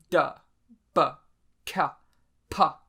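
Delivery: da, ba, ka, pa each hit hard and short; harsh sounds. Shown as a demonstration. Teacher sounding out harsh consonants, da ba ka pa, punched. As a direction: spit out da, ba, ka, pa as hard bursts. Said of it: "those are harsh sounds".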